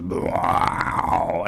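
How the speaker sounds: low mock drone of a didgeridoo; didgeridoo imitation. Explaining the drone to friends, low imitated didgeridoo drone. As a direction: hum it low and soft like a didgeridoo. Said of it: "it goes".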